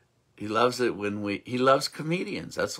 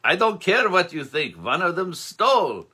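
insistent nobleman; 6 semitones higher, 6 dB louder than usual. Acted.

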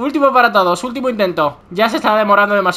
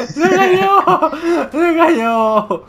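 much higher, laughing outburst of glee; gleeful laughing cry; 8 semitones higher, much slower than usual. Real, not acted. Gamer laughs at his friend falling off the map, gleeful cry. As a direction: crow with laughter at the rival's fall. Gloating as rival falls.

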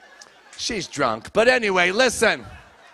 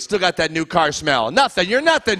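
normal talking voice

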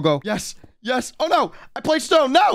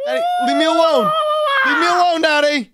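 panicked shout; faster than usual. Real, not acted. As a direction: yell in real panic at the chaser. Chased by dad in trees.

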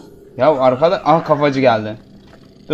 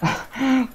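startled high laugh; 9 semitones higher, 5 dB quieter than usual. Real, not acted. Startled by game.

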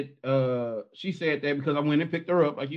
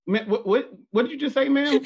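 startled disbelief; 8 semitones higher, 4 dB louder than usual. Retold or imitated. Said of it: "she was like".

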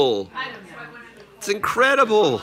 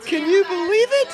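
put-on much higher squeaky baby talk. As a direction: pipe it up high for the baby.

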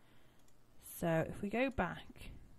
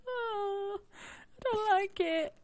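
high slow nervous whine; scared whimper; 13 semitones higher, 4 dB louder, much slower than usual. Real, not acted. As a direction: whimper in fright. Scared of spider.

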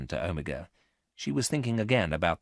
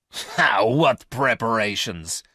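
scornful "Ha!" then slow, louder question; hard laugh; 4 semitones higher, 7 dB louder, slower than usual. A narrator's reading. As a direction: laugh hard, then challenge them slowly. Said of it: "asked Garth with a hard laugh".

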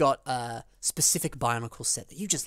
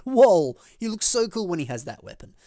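loud, high whoa of wonder; excited retold gasp of awe; retold amazement; 5 semitones higher, 9 dB louder than usual. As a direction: relive being wowed by the weapon. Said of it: "I was like".

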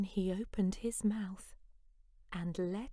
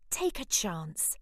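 plain reading voice

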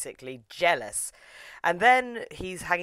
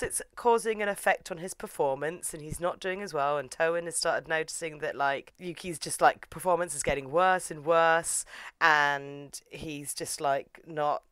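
normal talking voice

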